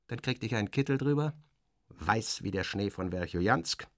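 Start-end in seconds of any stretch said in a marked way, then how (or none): none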